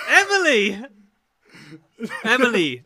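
loud mock call of a name; mock outraged call. Calling out to Emily in mock anger.

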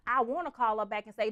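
ordinary talk

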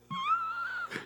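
very high, squealed pretend wail; hammed-up girlish sobbing; mock crying. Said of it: "she cried and cried".

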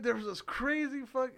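ordinary talk

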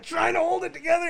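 high, strained pretend shout; mock straining. Joking he is Spider-Man holding the ship, mock straining. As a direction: shout as if hauling a ship together.

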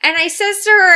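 high, loud, overbearing aunt; hammed-up Buffalo aunt impression; imitating aunt's voice. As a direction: put on a loud high-pitched aunt on the phone. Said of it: "my aunt gets on the phone".

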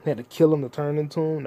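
ordinary talk

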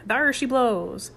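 seafaring call. A narrator's reading. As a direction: call it from the crow's nest. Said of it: "best seafaring manner".

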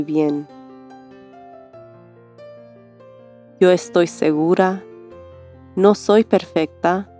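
calm them gently, line by line; soothing spa whisper. Acted. Leads a meditation. (voz suave de meditación guiada)

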